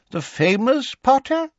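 put-on very high, rising old-man quaver; quavery old man. A narrator's reading. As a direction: marvel in a high, elderly voice. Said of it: "in his quavery old voice".

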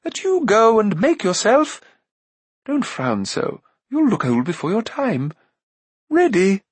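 high, measured queen voice; advising queen. Acted. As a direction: advise him in a high, lilting lady's voice.

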